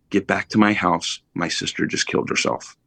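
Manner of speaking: ordinary talk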